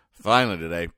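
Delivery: ordinary delivery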